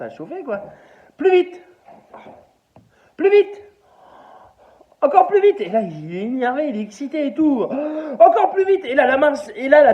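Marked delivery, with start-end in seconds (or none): 1.2-5.6 high, loud, eager cries of faster; breathless excited urging. Acted. Man urges the hand faster. 8.2-8.8 high, loud, eager cries of faster; breathless excited urging. Acted. Man urges the hand, laughing.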